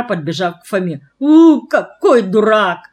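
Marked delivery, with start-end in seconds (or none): 1.2-2.8 loud, slow, drawn-out exasperation; flaring up. A narrator's reading. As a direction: scold him loudly, stretching the words. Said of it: "вспылила сестра". (сердито, громко и протяжно)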